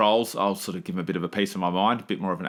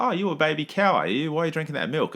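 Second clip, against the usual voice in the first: higher-pitched playful jibe at a brother; mock teasing; 8 semitones higher than usual. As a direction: imitate a fiancee needling her brother. Fiancee teasing brother over milk.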